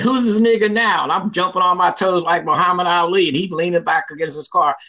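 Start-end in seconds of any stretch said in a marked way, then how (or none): 0.0-1.0 gloating taunt. Retold or imitated. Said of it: "I said".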